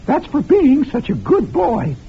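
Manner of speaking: mock-sweet granny delivering the prize slowly; sugary grandmother. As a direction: coo it proudly like a doting grandma. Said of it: "My grandmother says".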